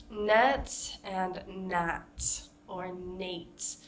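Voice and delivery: "Net and nat or nate." said very slowly with each vowel kept distinct; slow it right down to contrast "Net", "nat" and "nate"; a slow teacher contrast of "Net and nat or nate.". Shown as a demonstration. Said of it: "make sure that we can hear a difference".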